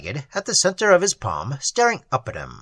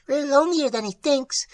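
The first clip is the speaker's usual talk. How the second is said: tiny troll voice; 10 semitones higher than usual. A narrator's reading. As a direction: sigh it like a sad tiny sprite.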